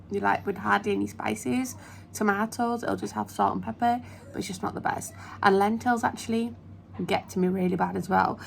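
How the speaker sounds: normal talking voice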